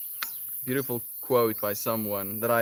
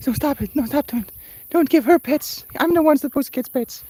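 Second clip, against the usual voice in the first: very high, loud jealous cat protest; voicing jealous cat; 13 semitones higher, 8 dB louder than usual. Streamer voicing her jealous cat Peppy, very high and whiny. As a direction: whine and fuss like a possessive pet. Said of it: "Peppy is like".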